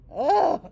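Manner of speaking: loud, high groan of disgust; loud disgusted ugh. Real, not acted. Teacher venting about noisy salons, loud disgusted groan. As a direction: let out a fed-up groan.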